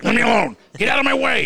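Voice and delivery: high, loud, panicked bark to clear the way; desperate yell. Retold or imitated. Uncle rushing to bathroom yells at relatives, loud and high. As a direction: shove past the hugging relatives, loud and high.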